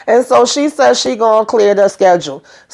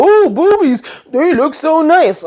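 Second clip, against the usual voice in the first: high, drawn-out mock swooning; mock ogling; 8 semitones higher, slower than usual. Cop gawking at the calendar picture, mock ogling. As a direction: imitate a smitten gawker, pitched way up.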